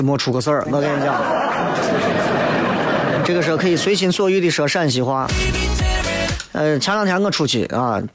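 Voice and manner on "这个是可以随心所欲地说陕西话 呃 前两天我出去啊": normal talking voice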